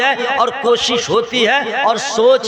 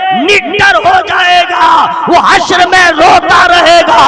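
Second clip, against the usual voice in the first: fiery preaching; 5 semitones higher, 7 dB louder than usual. Real, not acted. Preaching at full force.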